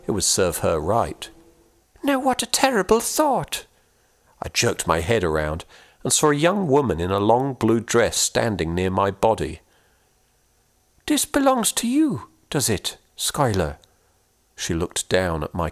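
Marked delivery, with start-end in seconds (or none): 2.0-3.6 high, lilting scolding voice; old ghost woman teasing the boy, gently chiding; Una chiding. Acted. 11.1-13.8 put-on high, lilting old-world woman; curious and kindly; Una's voice. Una voiced.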